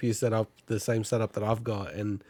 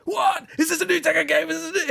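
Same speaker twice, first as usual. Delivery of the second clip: shocked shout. Retold or imitated. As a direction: shout it out in disbelief. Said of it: "they're all like".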